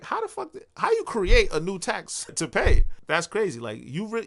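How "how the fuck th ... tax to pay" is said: high-pitched, baffled outburst, swelling louder; retold incredulity. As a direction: act out disbelief at a new tax. Retold reaction to new tax.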